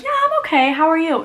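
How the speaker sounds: mock earnest reply. Newcomer wrongly answering a greeting, high-pitched mock earnest reply. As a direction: act out an over-polite reply. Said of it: "It doesn't mean, like".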